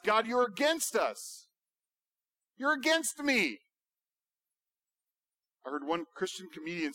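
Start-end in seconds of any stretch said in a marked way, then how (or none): 0.0-3.6 accusing God. Retold or imitated. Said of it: "Our accusation against God is".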